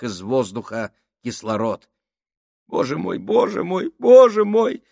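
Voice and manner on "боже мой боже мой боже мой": rising panicked whimper, building to a wail. A narrator's reading. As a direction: whimper it, growing louder each time. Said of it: "захныкал Сольц".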